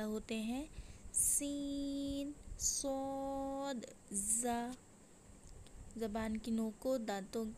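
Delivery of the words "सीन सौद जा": सीन and सौद held very long, hissed out; whistling letters. Shown as a demonstration. Teacher naming the whistling letters, very drawn out. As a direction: stretch सीन and सौद far out, the स hissing. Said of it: "दोनों दांतों के अंदरूनी किनारे से".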